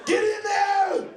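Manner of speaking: high, loud barked urging; hammed-up commando shouting; mock action-hero yell. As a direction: girlfriend in bed turned action hero, yelling orders. Girlfriend turns Schwarzenegger, yelling.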